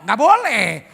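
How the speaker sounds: ordinary talk, nothing marked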